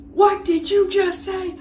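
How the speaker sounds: high, slow, appalled demand; shocked teacher. Retold or imitated. Teacher confronts a cursing pupil, hammed-up shock.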